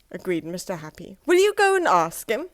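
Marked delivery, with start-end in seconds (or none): 1.3-2.5 eager ask. Acted. Happy eager request.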